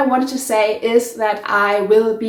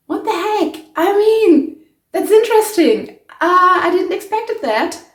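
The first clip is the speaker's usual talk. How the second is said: surprised; 7 semitones higher, 4 dB louder than usual. Real, not acted. Still reacting to the dye result.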